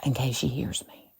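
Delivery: soft unvoiced whisper. Real, not acted. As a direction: hush it so she can't hear. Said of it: "why are you whispering".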